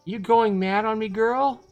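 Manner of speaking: loud, high-pitched aunt voice; alarmed scolding. Acted. Aunt startled by the girl's yell.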